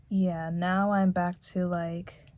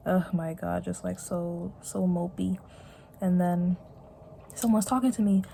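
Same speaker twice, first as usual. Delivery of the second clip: ordinary talk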